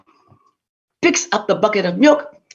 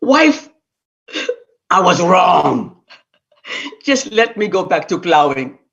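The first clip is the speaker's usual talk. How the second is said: sheepish husband; 4 semitones lower than usual. Acted. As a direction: own up humbly. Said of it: "all the poor man could say".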